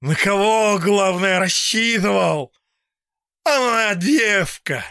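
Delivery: high, loud, bitter yell; bitter shouting. A narrator's reading. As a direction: hurl it at your enemies. Old man's bitter outburst.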